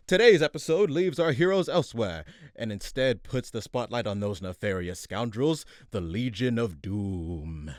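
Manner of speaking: grand announcer read, slowing on the name. Acted. Announcer introducing the villains to the audience, grand.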